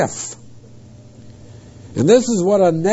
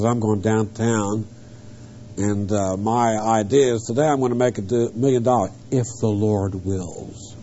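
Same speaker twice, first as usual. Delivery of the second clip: low, soft mock of someone making plans; casual planner; 6 semitones lower, 6 dB quieter than usual. Preacher imitating someone who misuses the verse, low and offhand. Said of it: "like someone gets up and says".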